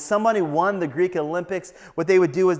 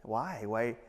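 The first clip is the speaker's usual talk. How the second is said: soft, low, halting bewilderment; hushed, humbled puzzlement; mildly confused; 3 semitones lower, 10 dB quieter than usual. Acted. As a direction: ask it quietly, stumbling on why. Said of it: "you're a little confused".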